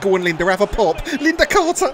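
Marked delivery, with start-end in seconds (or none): 1.2-1.9 high, loud shout of the name; frantic commentator cry; excited name call. Real, not acted. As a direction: holler as the shot is taken. Calling her shot.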